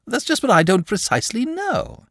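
high, chatty dragon voice; a lazy, cheerful dragon confiding; genial dragon. Acted.

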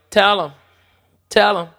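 high, loud, pressing; insistent. Retold or imitated. Wife urging him loudly to tell the doctor. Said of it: "Lana was like".

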